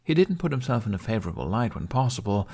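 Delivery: ordinary delivery, nothing marked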